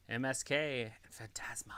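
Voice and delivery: unvoiced whisper of the names. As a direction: breathe the names out.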